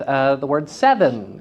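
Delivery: ordinary talk, nothing marked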